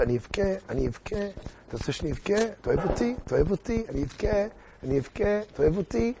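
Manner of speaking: soft, high-pitched mock wheedling; tearful pleading queen. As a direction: threaten tears to get love. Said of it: "איך לפתור את הבכי". (מלכה בכיינית בקול גבוה וחלש)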